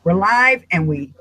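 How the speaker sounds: usual speaking voice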